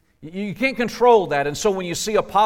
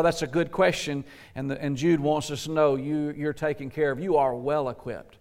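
ordinary delivery

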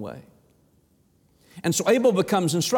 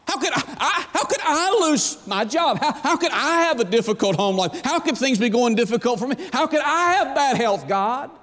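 high, pleading mock whine at God; imitating a self-pitying churchgoer's whine; whiny complaint; 6 semitones higher than usual. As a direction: complain like a hard-done-by child.

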